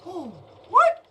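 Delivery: sudden yelped what; startled surprise. Real, not acted. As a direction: caught off guard by the cat. Cat does something unexpected.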